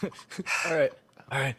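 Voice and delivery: normal talking voice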